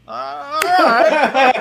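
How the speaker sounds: high, loud grudging crowd approval; crowd approving. Retold or imitated. Comic imitating crowd members grudgingly approving, high and loud. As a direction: call it out like a won-over audience member. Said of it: "I heard a couple people go".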